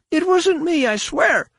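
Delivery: terrified captive swears innocence; frantic pleading. A narrator's reading. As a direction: beg frantically. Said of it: "the shamed one repeated frantically".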